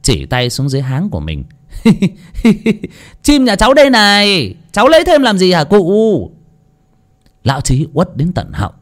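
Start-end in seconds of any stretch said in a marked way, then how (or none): 2.3-2.9 put-on high cackling boyish laugh; mocking laugh. Boy laughs at the landlord, cackling. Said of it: "Thằng Bờm cười ha hả". 3.2-6.3 high, louder teasing through laughter; cheeky laughing taunt. Acted. Boy taunts the landlord, laughing high. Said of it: "Thằng Bờm cười ha hả".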